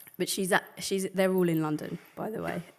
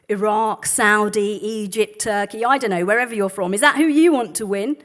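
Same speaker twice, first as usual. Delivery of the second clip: loud, eager taxi driver calling out; mock loud driver quizzing his passenger; loud cab driver; 3 semitones higher, 13 dB louder than usual. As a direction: play the eager driver over the traffic. Loud driver quoted in poem.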